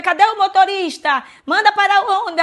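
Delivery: high, loud urgent call; retold shout for the driver. Passengers yelling for the driver to stop the bus, loud and high. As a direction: yell for someone to stop the bus. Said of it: "E aí grita o motorista".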